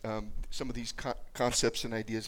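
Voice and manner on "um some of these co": usual speaking voice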